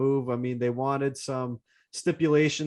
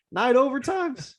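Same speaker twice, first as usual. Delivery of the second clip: voice crack; 10 semitones higher than usual. Real, not acted. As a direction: voice cracks with excitement. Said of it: "it was worth the voice crack there".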